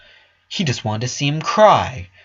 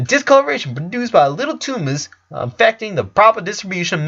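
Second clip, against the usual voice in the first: a higher, quick know-it-all doctor explaining a diagnosis; brisk doctor; 5 semitones higher than usual. Acted. Doctor explaining to Lincoln, higher and brisk. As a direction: lecture the boy like a smug physician.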